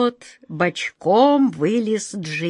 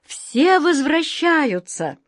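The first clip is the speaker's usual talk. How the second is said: excited; 8 semitones higher, much slower than usual. Acted. Joyful conclusion.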